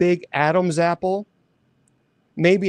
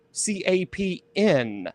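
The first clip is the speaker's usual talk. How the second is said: slow letter by letter "C A P N"; spelled out; slower than usual. Shown as a demonstration. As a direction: spell "C A P N" slowly and clearly. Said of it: "The Cap'n".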